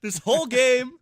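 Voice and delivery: high, loud, slow outraged protest. Real, not acted. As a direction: complain in high disbelief. Outraged after being killed.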